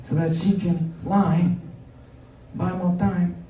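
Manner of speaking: a hint of a mob boss lecturing; lightly voiced godfather Trump teaching politics; mock godfather Trump. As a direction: teach the kid like a mafia don. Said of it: "Don Corleone Trump".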